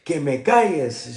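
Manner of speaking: angry retort. Retold or imitated. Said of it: "I said".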